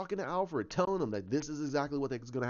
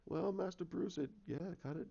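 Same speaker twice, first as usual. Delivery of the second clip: hushed butler's aside; much softer, hesitant correction; Alfred; 2 semitones higher, 9 dB quieter than usual. Retold or imitated. Butler gently correcting Master Bruce, soft and tentative.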